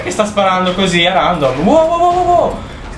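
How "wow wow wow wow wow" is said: startled, high-pitched string of wows. Real, not acted. Player caught off guard by random shooting, startled. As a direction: blurt it out high, in quick alarm.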